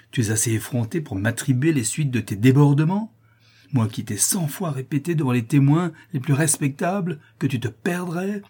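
scandalized master's outburst; feigned indignation. Acted. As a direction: rage at a servant, climbing as you throw them out. Master feigns outrage, dismisses servant.

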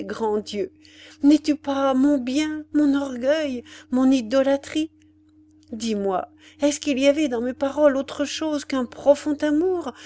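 tearful cry. A narrator's reading. Ethel clinging to Ordener, begging forgiveness through tears. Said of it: "en criant d'une voix étouffée par les larmes".

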